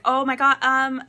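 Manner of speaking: loud exasperated outburst. Retold or imitated. Acts out her frustrated reaction.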